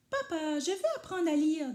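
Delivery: put-on high, eager little boy's voice; a small boy pleading to learn; child voice. A narrator's reading. Little Rikili asking papa to teach him, eager and high. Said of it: "dit Rikili".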